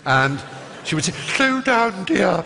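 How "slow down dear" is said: put-on high elderly teacher voice; speech teacher slowing down a rushing boy in a high voice; strange old lady voice. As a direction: gently correct him in a high old-lady voice. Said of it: "that sort of strange voice".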